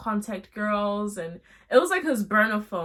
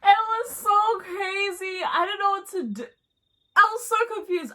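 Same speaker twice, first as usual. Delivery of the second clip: astonished; 10 semitones higher, 3 dB louder than usual. Real, not acted. Narrator telling viewers how shocked she was, much higher. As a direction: still reeling from it.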